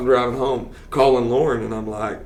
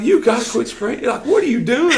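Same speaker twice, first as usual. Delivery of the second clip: mock scolding; 12 semitones higher than usual. Player scolding the coach.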